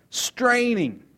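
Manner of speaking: normal talking voice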